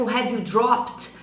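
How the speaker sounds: slow, careful question; slow model question. Shown as a demonstration. Teacher slowly models the perfect-tense question. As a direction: stretch every word. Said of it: "quando eu pergunto".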